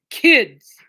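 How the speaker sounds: fed-up shout at the pets; loud weary scold; exasperated call. Real, not acted.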